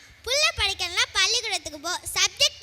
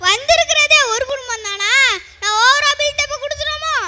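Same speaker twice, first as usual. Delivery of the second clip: loud, raised comic wondering; loud self-questioning; 3 semitones higher, 6 dB louder than usual. Acted. Attendant finds only one family in the queue, loud wondering. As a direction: wonder aloud at full voice.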